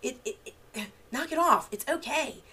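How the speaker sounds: high, loud, sighing knock it off; exasperated parent. Retold or imitated. Parent losing patience with a melting-down child, exasperated. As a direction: let the exasperation burst out. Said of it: "my response sometimes is like".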